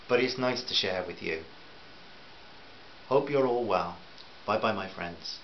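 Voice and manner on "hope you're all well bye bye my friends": ordinary delivery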